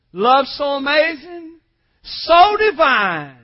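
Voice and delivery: slow, drawn-out hymn recitation; reverent, emphatic pulpit recitation; declaimed hymn verse. Real, not acted. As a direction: proclaim it slowly, stretching each phrase. Preacher declaims hymn lines.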